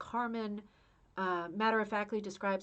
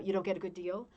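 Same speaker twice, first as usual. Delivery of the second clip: usual speaking voice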